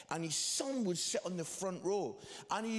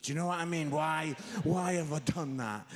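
ordinary talk

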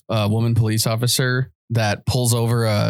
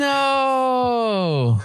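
long, high wail of denial; dismayed disbelief; 10 semitones higher than usual. Real, not acted. Storyteller seeing the clip prove them wrong, dismayed disbelief. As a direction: stretch it out, refusing to believe it.

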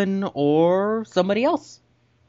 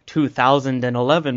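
normal talking voice

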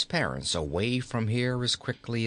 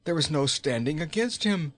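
gasped; 3 semitones higher than usual. A narrator's reading. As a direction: blurt it out in fright. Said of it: "she gasped".

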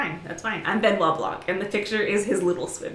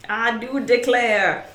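put-on Southern accent; 3 semitones higher, 5 dB louder, slower than usual. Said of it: "that was not a good Southern accent".

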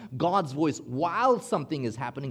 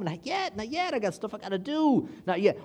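pleading; 6 semitones higher than usual. Retold or imitated. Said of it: "going through my head".

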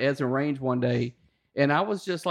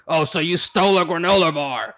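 imitating a drill instructor, a bit louder; mock drill instructor bark; 2 semitones higher, 6 dB louder than usual. Drill instructor mocking recruit.

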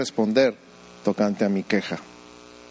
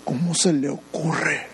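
mock indignation; 2 semitones higher, slower than usual. Pastor acting his own offended reaction to criticism, slow and indignant. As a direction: drag it out, mock-outraged. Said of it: "y yo".

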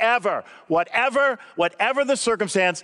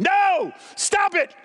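much higher mock yell; 8 semitones higher than usual. Pastor yelling at a TV athlete, mock yell. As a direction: yell at the TV, half in fun. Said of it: "I yell at my T V every time".

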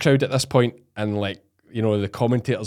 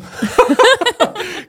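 high, loud peal of laughter; real high-pitched cackling laughter; 6 dB louder than usual. Real, not acted. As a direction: burst out laughing at your own story. Laughs at his own punchline.

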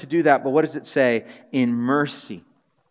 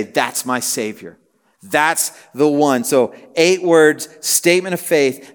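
raised, building sermon voice, stressing each title; fervent preaching; 3 semitones higher, 4 dB louder than usual. Acted. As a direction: preach it with growing fervour. Rising emphatic sermon climax.

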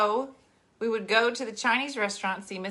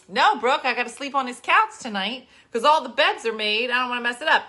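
louder, raised-pitch call across the room; real raised-voice aside; 4 semitones higher, 8 dB louder than usual. Real, not acted. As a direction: call over to someone, insistent. Calling instructions off to Brooke.

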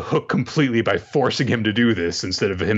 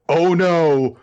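mock panic; 8 semitones higher, 4 dB louder than usual. Mocking the hero's imagined reaction.

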